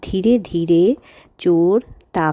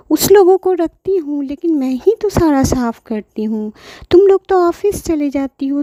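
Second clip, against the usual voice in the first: put-on higher, pleading old granny voice; grandmother pleading; 6 semitones higher than usual. Grandma pleads with daughter-in-law, higher pleading voice. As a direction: beg gently like a hurt grandmother.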